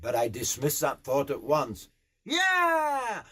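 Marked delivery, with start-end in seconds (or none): 2.2-3.2 long, very high "yeah"; exhilarated rider's holler; top-of-the-voice yell. Acted. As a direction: urge the horse on with a yell. Rider urges galloping horse on.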